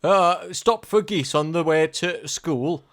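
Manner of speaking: hammed-up Yorkshire accent, slowly drawn out. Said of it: "Do a Yorkshire accent".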